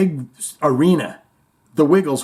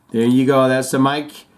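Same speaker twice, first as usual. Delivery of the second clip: ordinary talk, nothing marked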